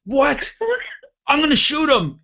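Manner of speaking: very high mock-outraged cry; high-pitched retold indignation; outraged threat. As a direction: imitate a buddy erupting in disbelief. Buddy's outraged threat, acted.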